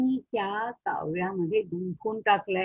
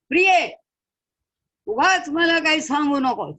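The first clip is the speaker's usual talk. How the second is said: loud, higher rebuke of his wife; angry shout; 4 semitones higher, 8 dB louder than usual. Acted. Sage shouts at his wife, loud angry rebuke. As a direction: shout her down. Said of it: "Gautam Maharaj परत ओरडले".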